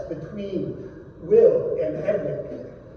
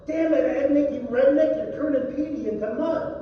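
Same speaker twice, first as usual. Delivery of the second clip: angry yell; 6 semitones higher, much faster than usual. Acted. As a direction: scold him across the boat. Orrin yelling at Ednick.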